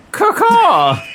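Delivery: high shrill bird caw; parrot squawk. Acted.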